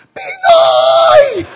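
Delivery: one long, high, very loud scream; imitated fan shouting. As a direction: shriek the name like a desperate fan. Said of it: "So she was shouting".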